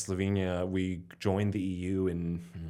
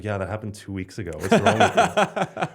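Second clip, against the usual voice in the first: mother's scolding; 8 dB louder than usual. Retold or imitated. Telling her son off about old news, laughing mock scold. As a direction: tease like an exasperated mother, climbing up to a squeal. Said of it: "she was like".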